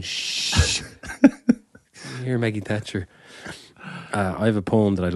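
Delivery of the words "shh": long hissed shush; imitated shush. Listener at home watching The Crown, shushing. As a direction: shush someone with a drawn-out shh. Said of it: "just going".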